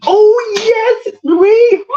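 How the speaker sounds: very high, squealed hello; hammed-up high-pitched burst of recognition; excited greeting. Streamer suddenly greeting a viewer named Marie, excited squeal.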